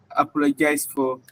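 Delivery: normal talking voice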